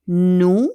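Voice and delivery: NOUS drawn out loud as one long "noo"; model repetition. Shown as a demonstration. Teacher has the class repeat the pronoun, loud model NOUS. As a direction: say NOUS loudly and clearly for learners. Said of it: "Let's repeat".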